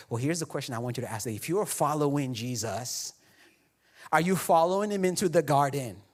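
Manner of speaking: usual speaking voice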